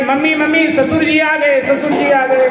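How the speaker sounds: high, excited announcing call; crying out. Acted. Bride announces father-in-law instead of butter, crying out. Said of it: "जोर से चिल्लाई".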